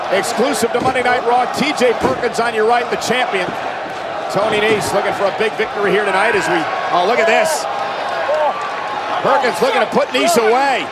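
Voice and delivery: normal talking voice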